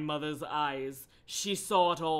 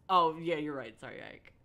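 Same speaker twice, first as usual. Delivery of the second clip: usual speaking voice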